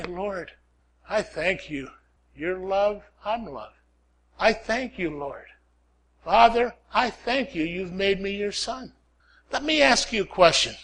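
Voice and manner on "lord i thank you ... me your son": hushed prayer. Acted. Preacher showing how he prays, hushed and soft. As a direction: pray it quietly, almost to yourself.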